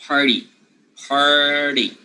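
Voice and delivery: Party with a clear r and flapped t; party repeated with the r held; correct party. Shown as a demonstration. Teacher drilling party with the r for students. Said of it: "So the R should remain".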